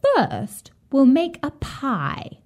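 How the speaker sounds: lightly voiced high old witch; scheming witch. A narrator's reading. Witch plots to trick Gretel with baking, scheming high voice.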